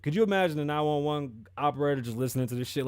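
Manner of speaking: normal talking voice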